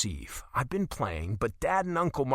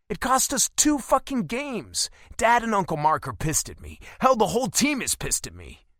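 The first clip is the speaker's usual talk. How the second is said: frustrated rant; 6 semitones higher, 4 dB louder than usual. Acted.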